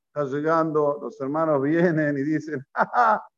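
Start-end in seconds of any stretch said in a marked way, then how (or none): 2.7-3.3 acted scornful ha-ha; higher, put-on laugh; mocking laugh. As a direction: laugh like jeering brothers. Brothers mocking Joseph's arrival.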